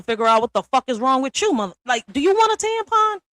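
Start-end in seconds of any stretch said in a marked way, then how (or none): 2.1-3.2 mocking question. Mocking a moody man.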